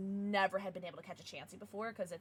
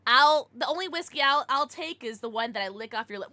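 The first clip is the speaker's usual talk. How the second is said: ordinary talk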